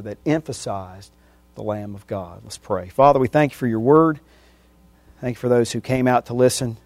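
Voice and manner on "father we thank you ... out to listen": quiet prayer, fading softer and softer; closing prayer. Acted. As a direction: pray humbly, letting the voice sink.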